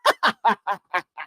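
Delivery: laughing burst cutting off the sentence; amused laughing fit; real laughter. Real, not acted. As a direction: crack up helplessly. Cracking up at the eyebrows.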